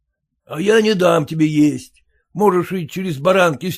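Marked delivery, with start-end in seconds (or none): none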